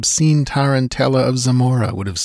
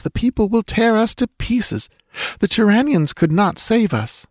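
much higher, pressing woman's voice, quick in middle; urgent young woman; 11 semitones higher than usual. Acted. Dancer urging Conan to flee the temple, urgent high voice. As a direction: hurry him along with fear.

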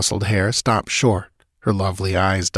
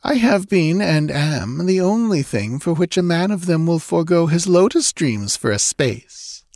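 high, sultry priestess; lightly voiced seductive woman; temptress voice; 9 semitones higher than usual. Thalos voiced by narrator.

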